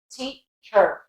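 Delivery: American teacher with a strong final R; teacher ending in a full "cher", American; American accent. Shown as a demonstration. Teacher contrasting with British, American accent. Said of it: "Whereas American".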